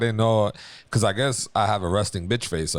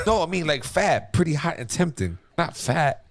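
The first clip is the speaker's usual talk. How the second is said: hasty backpedalling; 7 semitones higher than usual. Acted. Acted backpedal after insulting wife.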